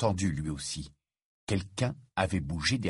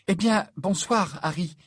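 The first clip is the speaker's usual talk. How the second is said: abrupt goodnight; 9 semitones higher than usual. A narrator's reading. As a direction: sign off stiffly and suddenly. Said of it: "lança-t-elle".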